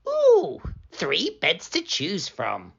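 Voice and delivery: high-pitched ooh settling into wonder; lightly voiced little girl finding a treat; Goldilocks. Goldilocks delighted at the beds.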